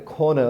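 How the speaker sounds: corner as کھون with no R; British pronunciation. Shown as a demonstration. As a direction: say کھون with puffed K and silent R. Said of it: "وہ نہیں نکال رہے". (برطانوی "کھون"، ر کے بغیر)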